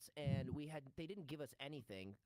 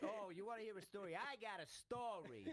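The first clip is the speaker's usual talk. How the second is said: Tony Pajamas voice; 7 semitones higher than usual. Retold or imitated. Mob character teasing the kid with a story, hammed-up. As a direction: ham up a goofy gangster offering a story. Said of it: "And he goes".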